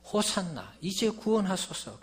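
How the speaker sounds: high-pitched, restrained cheer of a crowd; imitated fervent cry. Preacher voicing the crowd hailing Jesus, a fervent cry. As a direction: call out eagerly as the welcoming crowd. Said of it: "그렇게 열렬하게 외치던".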